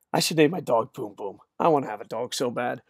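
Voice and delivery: usual speaking voice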